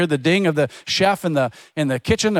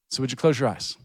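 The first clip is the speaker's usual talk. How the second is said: closing prayer. Acted.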